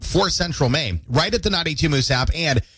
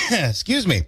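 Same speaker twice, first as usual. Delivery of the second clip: throat cleared after choking on coffee; recovering from a sip gone wrong; choking on a drink; 6 semitones higher than usual. Real, not acted. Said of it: "Choking on my iced coffee here".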